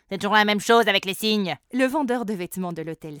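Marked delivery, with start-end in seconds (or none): 0.1-1.6 loud, gruff duck gentleman; grumpy old duck. A narrator's reading. Duck grumbles again. (vieux canard bougon, fort)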